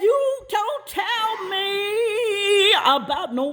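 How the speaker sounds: high, drawn-out outraged line; sung-out high scolding; mother's outrage. Retold or imitated. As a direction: stretch it out high in disbelief.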